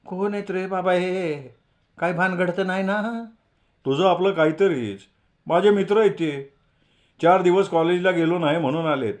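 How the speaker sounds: a mother nervously asking her son; mother asking Jagu about the strangers; worried village mother. A narrator's reading. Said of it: "जगूची आई आली".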